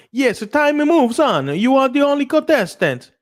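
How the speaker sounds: high, loud hammed-up Italian; whiny hungry Italian kid asking Mama for spaghetti; mock Italian accent. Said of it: "the accent sphere".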